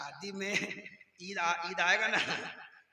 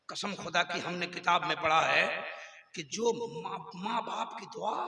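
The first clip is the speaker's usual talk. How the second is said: ordinary delivery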